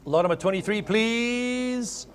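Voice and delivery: ordinary talk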